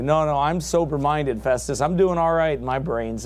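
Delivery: ordinary delivery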